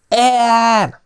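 put-on very high, loud drunken yell. A narrator's reading. Drunk Ellen yells wildly at the table, very high and loud. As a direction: bellow the name out. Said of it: "ordította Ellen".

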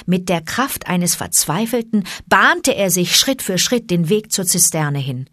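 ordinary delivery

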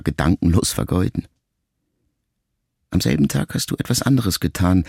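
usual speaking voice

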